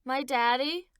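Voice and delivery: put-on high, loud child voice; proud little boy. Boy telling mother daddy is here, proud. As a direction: announce it proudly. Child announces father's arrival.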